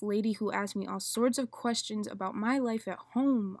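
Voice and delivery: loud, intense slam poem delivery; spoken word poetry. Acted. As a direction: perform it like a slam poet on stage.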